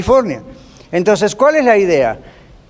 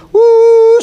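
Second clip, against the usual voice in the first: high, loud, held train hoot; long, loud "woo" imitating a train; imitated train whistle; 7 dB louder than usual. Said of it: "los niños decíamos".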